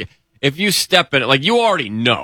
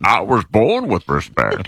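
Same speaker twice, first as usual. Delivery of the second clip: lower, put-on villain voice; mock a movie villain's declaration; comic masked-villain impression; Bane; 4 semitones lower than usual. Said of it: "It sounded like Bane".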